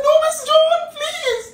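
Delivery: begging the manager to keep her job, crying; desperate begging. Retold or imitated. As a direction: beg through tears not to be fired. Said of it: "I began to beg".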